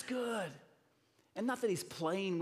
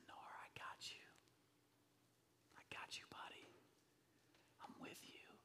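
very soft fatherly whisper; tender reassurance; 19 dB quieter than usual. Retold or imitated. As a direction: whisper it close to comfort your child. Father comforting daughter while dancing.

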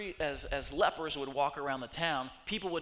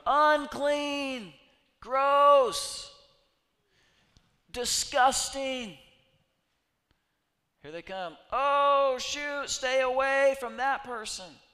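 disgusted townsperson; 11 semitones higher, 12 dB louder, much slower than usual. Retold or imitated. Townspeople recoiling from a leper.

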